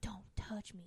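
hushed, barely voiced whisper; mock tense whisper. Retelling Leia pulling away from Han, hushed whisper. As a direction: whisper it like a tense love scene.

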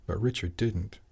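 ordinary delivery

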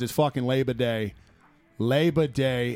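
ordinary talk

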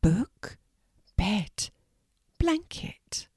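soft, whispered list of words; quiet voice. A narrator's reading. Panicked mermaid quietly naming things for Morgana. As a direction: barely voice the words, shy and small. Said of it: "in a quiet voice said".

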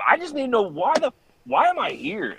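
pressing the investigators in mock exasperation; agitated. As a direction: press them for answers, voice climbing. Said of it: "And I was like".

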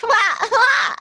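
high, loud bleating wah; baby goat bleat. Retold or imitated. Friend mimics Jenny as a baby goat, high loud bleat. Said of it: "baby goat".

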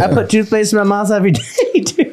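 high, fast, laughing through words. Real, not acted. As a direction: giggle mid-sentence. Laughing through bean taste.

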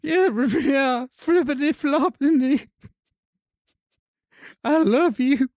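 hammed-up blubbering love declaration; cartoonish crying high squeak; mock sob. Overwrought reconciliation.

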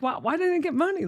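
put-on high, fast, baffled child voice; puzzled kid. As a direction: squeak it out like a confused little kid. Kid retelling confused reaction.

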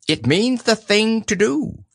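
high, patient owl voice. Acted. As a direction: explain it in a high-pitched owl voice. Owl answers Pooh's question.

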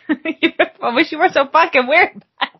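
high Minnesotan voice through laughter; giggly, hammed-up Minnesotan; Minnesotan accent. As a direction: tease in laughing mock Minnesotan. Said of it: "worst Minnesotan accent I've ever done".

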